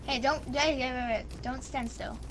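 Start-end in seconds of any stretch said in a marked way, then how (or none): none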